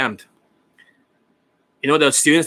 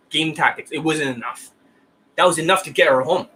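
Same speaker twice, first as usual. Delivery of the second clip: usual speaking voice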